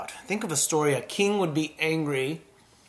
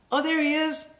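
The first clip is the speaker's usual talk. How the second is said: pleased surprise; 12 semitones higher than usual. Retold or imitated. Spotting the cat.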